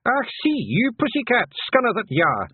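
high-pitched little fighter's yell. A narrator's reading. Feegle grabbing the cat by the ears, high taunting yell. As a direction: yell insults in a high, feisty voice. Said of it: "he yelled".

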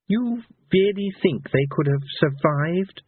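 high, slow question; incredulous minstrel. A narrator's reading. Minstrel questioning the villain's hope, incredulous. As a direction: ask in drawn-out disbelief.

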